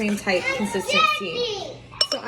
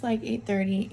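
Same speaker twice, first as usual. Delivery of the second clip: usual speaking voice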